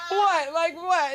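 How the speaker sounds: high, incredulous what; shocked. Retold or imitated. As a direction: gasp it out in disbelief. Shocked reaction to therapist.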